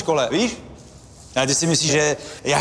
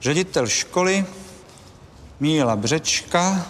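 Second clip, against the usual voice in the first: slow phrases with long pauses, sinking lower; dictating while writing; 4 semitones lower, slower than usual. Acted. Headmaster adds a written statement, slow halting dictation. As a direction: voice the words as you write them down. Tak to ještě připíšu.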